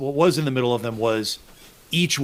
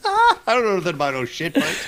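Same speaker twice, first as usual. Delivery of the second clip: laughing mock quote; 5 semitones higher than usual.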